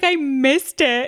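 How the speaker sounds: slow, lightly voiced guilty father; hammed-up guilty dad, slow; mock tearful dad. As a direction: drag it out like a weepy dad. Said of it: "He's like".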